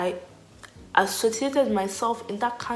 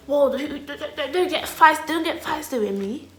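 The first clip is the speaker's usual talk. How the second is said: high, stammered mock warning; imitated boy's warning; 8 semitones higher, 4 dB louder than usual. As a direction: playfully mimic a boy backing her off. Imitates the boy warning her.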